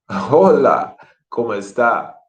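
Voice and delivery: "Hola, ¿cómo está?" with a warm laugh, really asking; genuine greeting. Shown as a demonstration. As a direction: greet a friend you're glad to see. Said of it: "be genuine".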